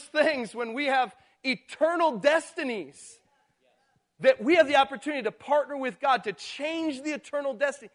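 preaching at full force. Real, not acted. Pastor pressing the congregation on eternity, preaching hard. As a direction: thunder out eternal stakes.

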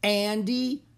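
loud answer with a hitch before the last name; yelling back. A narrator's reading. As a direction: holler the names back. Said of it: "Annie yelled back".

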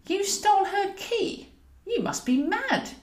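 put-on high, slow voice of an elderly lady; an old woman scolding her friend; Eleanor's voice. Elderly Eleanor scolds Roz, incredulous and high.

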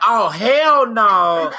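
slow, loud, drawn-out refusal; sassy hell no. Retold or imitated. Man imitating a woman refusing wrong food, slow and sassy. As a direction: stretch it out with full attitude. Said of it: "And the woman's like".

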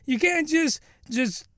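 usual speaking voice

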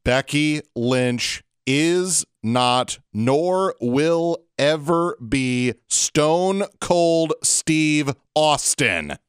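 very slow, every word spaced out; deliberately slow, emphatic rant; said slowly. Shown as a demonstration. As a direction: spell it out slowly like to a child. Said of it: "I have to say this slowly".

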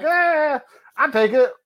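high, amused shrug of a voice; accepting the overlord title with a high shrug; shrugging acceptance. Retold or imitated. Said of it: "I was like".

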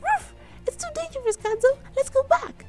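very high, fast alarmed plea; frightened Katya. A narrator's reading. Katya warning Kadzo about the monster, high frightened plea. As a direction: beg her to come back in panic.

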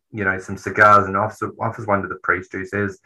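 ordinary delivery

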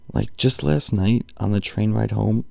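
ordinary talk

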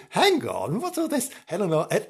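usual speaking voice

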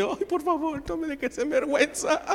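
high-pitched, comic begging; panicked inner plea. Retold or imitated. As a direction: plead silently in a squeaky panic. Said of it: "Y en mi mente".

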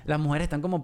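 ordinary talk, nothing marked

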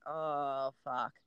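low muttered oh fuck; quiet dismay. Retold or imitated. Runner dreading the neighbor, quiet and low. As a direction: groan under your breath. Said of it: "And I'm like".

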